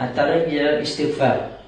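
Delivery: normal talking voice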